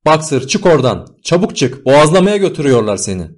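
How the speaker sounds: high, loud desperate plea; anguished, urgent shouting; heart-rending cry. A narrator's reading. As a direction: scream a warning to save a friend. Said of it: "diye haykırdı yürekleri dağlayan bir sesle".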